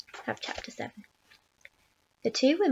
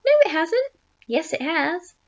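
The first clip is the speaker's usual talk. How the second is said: high, raised bickering voices; squabbling; 5 semitones higher, 6 dB louder than usual. A narrator's reading. Aunts arguing whether it stopped, high squabbling. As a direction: contradict each other, high and insistent.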